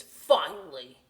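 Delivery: put-on loud, sour old man "Finally"; grumpy relief. Hungry old man finally reaching the cafe, grumpy. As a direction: bark it out like a fed-up old man.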